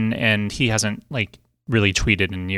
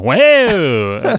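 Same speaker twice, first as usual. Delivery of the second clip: loud, high drawn-out whoa; mock alarm; 4 semitones higher, 9 dB louder than usual. Co-host pretends he is being touched, mock alarm. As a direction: exclaim as if touched somewhere unexpected.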